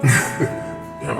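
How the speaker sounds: ordinary delivery, nothing marked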